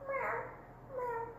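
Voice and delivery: high, soft meowing kitten; kitty cat meows. Pretend. Teacher playing a kitty cat in yoga. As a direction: meow like a little cat. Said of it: "your kitty cat makes some sounds".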